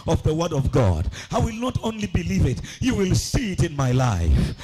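loud, driven sermon delivery; forceful preaching. Real, not acted. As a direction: drive each phrase home to the congregation. Preaches at full force to congregation.

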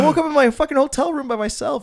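high, loud pleading yell; mock indignant protest. Son defends himself to his mom, high indignant yell. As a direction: shout your alibi way up high.

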